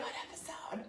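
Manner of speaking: a soft mock whisper of confusion. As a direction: breathe it out, lost and puzzled. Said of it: "I was like".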